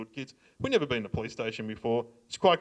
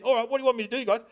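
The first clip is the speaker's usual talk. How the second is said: put-on eager young kid; 10 semitones higher, much faster than usual. Young mate voiced in story.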